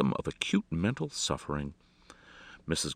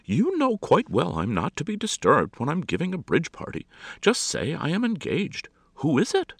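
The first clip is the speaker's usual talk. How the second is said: high, imperious scolding; exasperated society hostess; expostulating; 12 semitones higher, 5 dB louder than usual. A narrator's reading. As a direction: scold the maid in a loud, high voice. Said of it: "expostulated Missus Galante".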